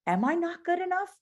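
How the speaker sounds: rising, doubtful questions; questioning yourself. Retold or imitated. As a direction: gently challenge the thought, pitch climbing high. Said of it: "and be like".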